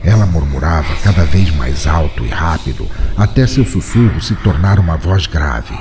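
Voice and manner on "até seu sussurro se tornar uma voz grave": ordinary delivery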